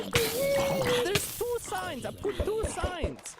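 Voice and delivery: high, slow, squeaky cartoon voice; cartoon character voice. Real, not acted. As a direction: stretch it out like a cartoon. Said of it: "you sound like a damn cartoon character".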